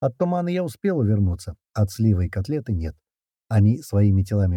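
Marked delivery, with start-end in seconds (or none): none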